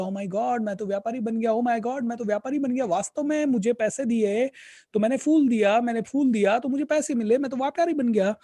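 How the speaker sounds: building from wonder to thrilled discovery; excited realisation. Retold or imitated. Beggar alone at night realising he became a trader, excited and rising.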